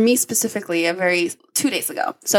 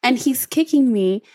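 ordinary delivery

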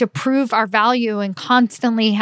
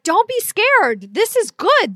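much higher, slow and eager reassurance; encouraging; 11 semitones higher than usual. Retold or imitated. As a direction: lift her up with a high, eager cheer. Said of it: "And I was like".

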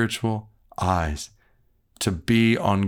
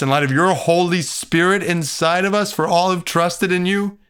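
rising, louder fervent prayer; earnest prayer; 4 semitones higher, 3 dB louder than usual. Acted. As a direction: pray it with growing fervor.

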